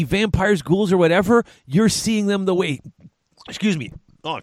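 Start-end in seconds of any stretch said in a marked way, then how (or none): 3.5-4.4 coughing, choking on own spit; sputtering through a choke. Real, not acted. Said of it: "choking on my own spit".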